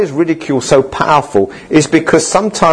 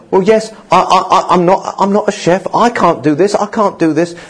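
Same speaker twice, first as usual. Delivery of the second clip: higher, stammering protest of inadequacy; discouraged self-doubt; 5 semitones higher than usual. Acted. Would-be cafe owner giving in to ridicule, stammering self-doubt. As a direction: act out confidence giving way, tripping on the words. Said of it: "the ridicule thrown back".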